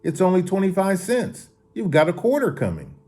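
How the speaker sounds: calling after someone. A narrator's reading. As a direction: call after him without really shouting. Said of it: "Mario called to him".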